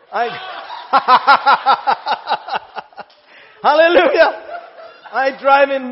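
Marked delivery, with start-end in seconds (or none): none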